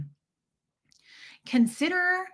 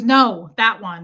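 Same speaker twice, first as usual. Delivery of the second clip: loud, impatient correction; rude and demanding; 9 dB louder, much slower than usual. Retold or imitated. Customer correcting the clerk, loud and demanding. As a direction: order her sharply to the right one. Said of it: "just demanding".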